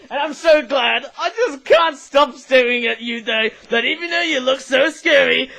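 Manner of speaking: loud, laughing delight; overjoyed, laughing as you praise it; gushing praise. Real, not acted. Gushing over the snatcher toy.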